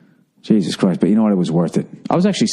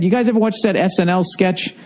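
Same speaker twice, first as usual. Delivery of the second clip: usual speaking voice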